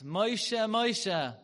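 loud, high calling of the name. Retold or imitated. God calling out to Moshe, loud and high. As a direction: call across a distance. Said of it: "calling out to us".

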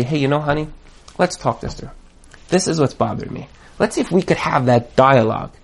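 calm your spouse down, softly. Pretend. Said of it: "sit down very calmly and say".

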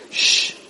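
imitated long hushing shh. Levium quieting the crying people, hushing shh. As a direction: hush them with a drawn-out shh. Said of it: "to make them quiet".

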